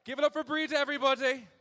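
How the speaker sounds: loud, high announcer shout; big crowd hype. Real, not acted. Comedian hyping crowd.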